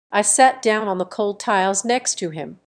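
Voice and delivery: usual speaking voice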